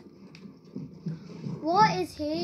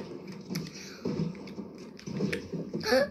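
sharp breathy gasp; 9 semitones higher, 6 dB quieter than usual. Real, not acted. Surprise in game.

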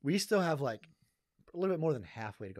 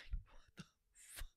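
breathy disbelieving whisper; 16 dB quieter, much slower than usual. Retold or imitated. Imagined reaction, whispered.

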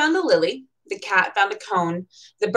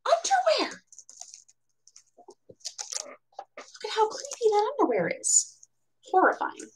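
high, soft hammed-up spooky voice; whisper-tease the horror; mock spooky voice; 14 semitones higher, 9 dB quieter than usual. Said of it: "mock horror commentary".

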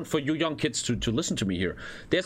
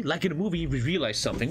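usual speaking voice

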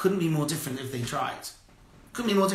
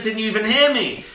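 loud, high mock cry of frustration; hammed-up wife, voice thrown up high and loud; exasperated wife; 9 semitones higher, 6 dB louder than usual. Rabbi voicing the fed-up wife at her husband, loud and high. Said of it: "then she said".

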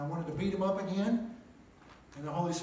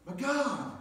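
high, loud, indignant shouting at God; a man arguing with God, shouting up at him in indignation; angry protest; 9 semitones higher, 6 dB louder than usual. Retold or imitated. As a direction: yell your case to the Lord, outraged. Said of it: "I kept arguing with God".

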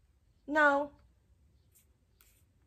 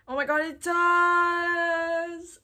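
high, loud, long-held last word; thrilled; 7 semitones higher, 6 dB louder, much slower than usual. Real, not acted. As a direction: stretch it into a delighted squeal. Thrilled the hat is included.

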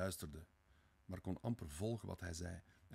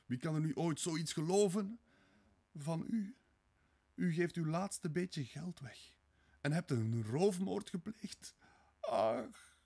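high, loud, distraught cries; anguished high-pitched outburst; screaming; 9 semitones higher, 8 dB louder than usual. A narrator's reading. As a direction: cry out in horrified disbelief, building higher. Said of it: "schreeuwde ze".

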